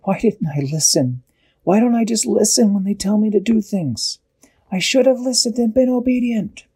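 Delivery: high-pitched, climbing self-reproach; distressed regret. A narrator's reading. Scared Dashi regrets not listening, high distressed voice.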